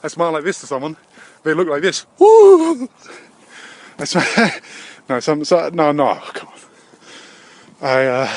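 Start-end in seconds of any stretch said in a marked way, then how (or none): 2.2-2.9 high, loud "woo"; short, very high-pitched imitated whoop; mock startled whoop. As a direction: whoop like someone reacting to a strange face. Mimics people's reaction to his look.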